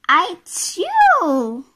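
loud, high "achoo"; acted sneeze. Mister Sneeze sneezes in the story, acted sneeze. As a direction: burst out with a big pretend sneeze. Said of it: "sneezed Mister Sneeze".

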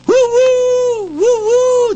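high, loud woo-woo from the cave; answering hoot. Acted. A voice in the cave answers the naked hunter, hooting loud.

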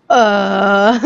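high, startled burst of laughter; surprised laugh. Real, not acted. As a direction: laugh caught off guard. Surprised by a name prompt.

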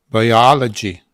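"Biology" with a loud, long second syllable; stress on second syllable. Shown as a demonstration. Teacher showing where the accent falls, loud stressed syllable. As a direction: punch the O in "Biology". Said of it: "akcent, to trzeba dać".

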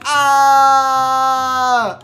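loud drawn-out play scream; long mock scream. Scream while climbing in game.

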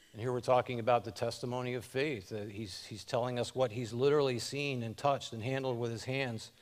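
ordinary delivery

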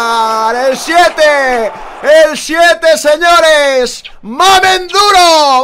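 high, loud celebratory yelling; excited shout. Real, not acted. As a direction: roar it to the crowd. Goal celebration, commentator shouting.

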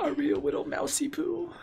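put-on high cooing baby talk to a rat. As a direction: coo it like talking to a pet.

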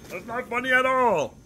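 a strained Kermit the Frog impression held through gameplay. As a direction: push the voice up and squeeze it like a felt frog. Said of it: "do the Kermit voice for two minutes".

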